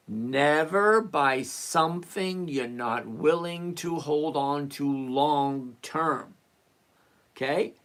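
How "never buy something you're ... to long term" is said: slow, drawn-out rule for slow learners; measured, stretched-out delivery so it sinks in; deliberately slow. Shown as a demonstration. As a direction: spell it out slowly, word by word. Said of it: "I'm gonna say it slow so you can understand".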